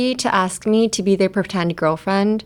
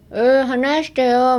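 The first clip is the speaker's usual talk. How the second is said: higher, drawn-out pinched-cheek whine; slow, higher, squashed-mouth demo voice; 5 semitones higher, 3 dB louder, slower than usual. Shown as a demonstration. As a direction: talk as if your cheeks are being pinched. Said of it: "ほっぺたをつまんで".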